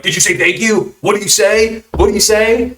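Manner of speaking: put-on high, sing-song nudge to a child; imitated prompting parent. Parents hovering off to the side, prompting in high voices. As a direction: mimic parents coaxing out manners.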